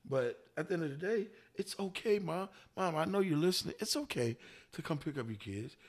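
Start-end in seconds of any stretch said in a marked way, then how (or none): none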